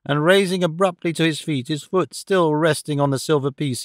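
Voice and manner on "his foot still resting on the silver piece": ordinary delivery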